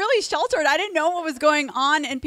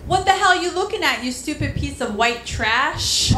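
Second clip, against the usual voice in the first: higher, fast, mock-furious snap; angry rollerblader; 4 semitones higher than usual. Rollerblader yelling at the comedian on the sidewalk, mock-furious and fast. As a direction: bark it at the stranger like an irate skater. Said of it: "She's like".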